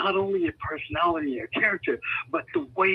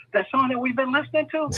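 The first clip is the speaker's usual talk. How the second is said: amazed question; 10 semitones higher than usual. Retold or imitated. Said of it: "I'm like".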